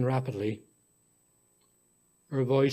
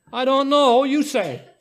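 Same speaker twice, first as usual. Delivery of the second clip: put-on high, louder little girl; carefree child; 9 semitones higher, 5 dB louder than usual. A narrator's reading. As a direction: shrug it off like a child. Child answers narrator.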